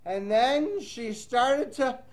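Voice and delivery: lively read-aloud storytelling voice; reading to children. Acted. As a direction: read the rhyme to young kids. Teacher reads picture book aloud.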